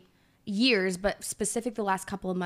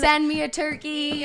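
mock dramatic plea; 7 semitones higher, 6 dB louder than usual. Continuing the joke.

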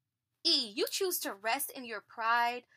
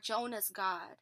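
usual speaking voice

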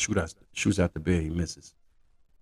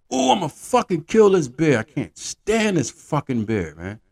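higher, louder hammed-up rage, then slower; vengeful hunter; 8 semitones higher, 3 dB louder than usual. Hunter swearing revenge on the bear, hammed-up angry. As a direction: ham up the hunter's fury.

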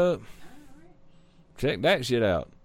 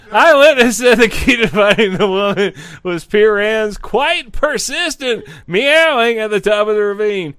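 loud news read; 5 semitones higher, 7 dB louder than usual. Acted. Reading news, getting louder.

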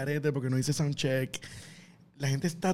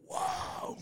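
stunned whisper; 11 dB quieter than usual. Retold or imitated. Sitting stunned in the car.

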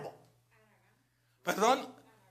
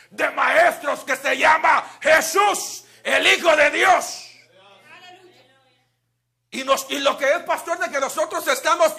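louder, higher preaching build; full preaching; 3 semitones higher, 5 dB louder than usual. Acted. Preacher to congregation about Jesús, loud preaching. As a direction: proclaim it to the church.

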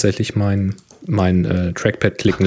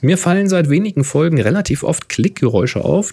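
reading aloud; 7 semitones higher than usual. Acted. Reads listener question aloud.

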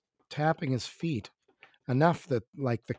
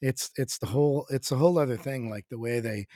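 ordinary talk, nothing marked

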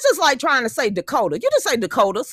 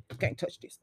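whisper; 6 semitones lower, 13 dB quieter than usual. Pretend. Co-host teasing about Texas barbecue, whispered. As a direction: drop to a teasing whisper on "You can't touch this". Riffing on the [name] catchphrase.

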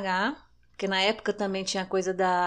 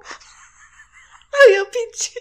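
high, loud laughing through the words; crying with laughter; 13 semitones higher, 11 dB louder, slower than usual. Real, not acted. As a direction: laugh so hard it cracks. Laughing while telling tattoo story.

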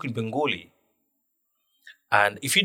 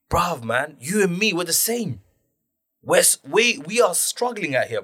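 higher-pitched, exasperated appeal; pleading protest; 7 semitones higher than usual. Retold or imitated. Minority man appealing to another who mocked them, exasperated. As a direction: reason with one of your own, hurt. Imagined plea to fellow minority.

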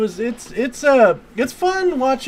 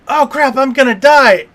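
mock panic; 7 semitones higher, 10 dB louder than usual. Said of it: "she goes".

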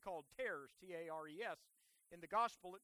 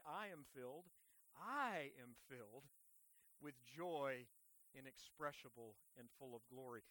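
reverent recitation; 9 semitones lower, 6 dB quieter than usual. Real, not acted. Preacher leads the church in saying the verse, reverent recitation. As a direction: let the verse sink low and soft, phrase by phrase.

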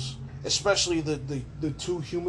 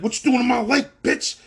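higher and louder, threatening yell; squid's loud challenge; 8 semitones higher, 8 dB louder than usual. Retold or imitated. Squid confronting Kong in its lake, mock loud yell. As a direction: bark it at the intruder.

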